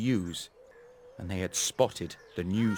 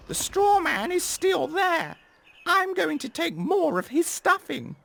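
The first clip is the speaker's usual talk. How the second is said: high, louder cocky crow voice; 4 dB louder than usual. Acted. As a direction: brag like a greedy crow.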